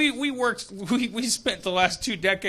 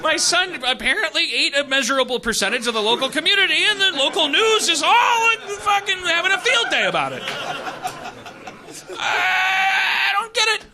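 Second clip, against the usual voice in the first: mock outrage; 9 semitones higher than usual. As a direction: rail at the news in mock despair. Voicing the father's outrage.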